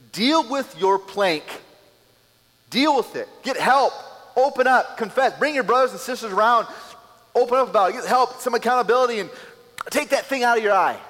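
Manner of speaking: loud, high, emphatic exhortation; voicing Jesus urging firmly; urgent command. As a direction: drive each command home.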